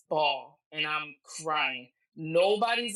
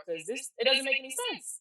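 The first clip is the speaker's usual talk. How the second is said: higher-pitched, baffled protest; retold disbelief; 8 semitones higher than usual. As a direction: protest the news as impossible. Acts out disbelief at death news.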